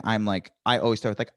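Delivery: ordinary delivery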